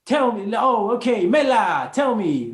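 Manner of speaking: high, loud, abrupt mock woman's voice; high, loud mock Maltese woman demanding to be told. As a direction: ham up a brash, bossy Maltese woman. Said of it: "their tonality is not good".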